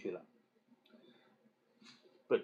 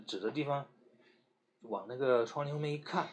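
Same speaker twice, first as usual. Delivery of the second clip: plain reading voice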